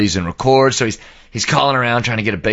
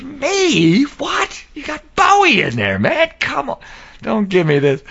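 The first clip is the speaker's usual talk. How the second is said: high, squealed incredulous protest; mock disbelief; slower than usual. Storyteller replaying Grohl waving off praise, high mock incredulity. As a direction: pitch it sky-high, scoffing in disbelief.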